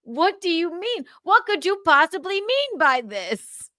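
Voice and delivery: mock-baffled child, pitched high; bewildered kid. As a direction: demand an answer in disbelief. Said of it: "but I'm like".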